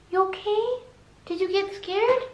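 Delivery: high, soft questions to a pet; concerned dog talk. Real, not acted. Owner asks dog if it got scared, high soothing voice. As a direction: check gently on a frightened dog in a high voice.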